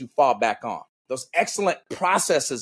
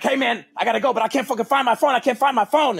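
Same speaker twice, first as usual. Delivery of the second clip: high, fast, panicky rant; flustered and hurried, hunting for the phone; higher, loud, rushed retelling of panic; frantic rushing; 8 semitones higher, 4 dB louder, faster than usual. Retold or imitated. Retold frantic rush for phone.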